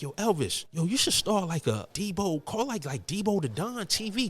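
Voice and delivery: high-pitched Puerto Rican gangster buddy; gangster friend impression. Gangster friend pitching a YouTube channel name, high-pitched impression. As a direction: pitch way up, imitating an excited pal. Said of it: "one Puerto Rican guy who's a gangster".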